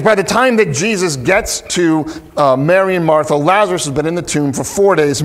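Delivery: ordinary talk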